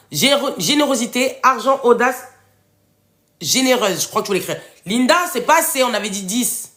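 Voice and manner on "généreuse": ordinary talk, nothing marked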